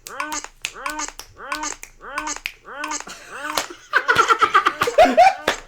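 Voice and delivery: high-pitched drawn-out laughter; long high laughing fit. Real, not acted. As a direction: burst into a long cackle. Huge laugh at the snap.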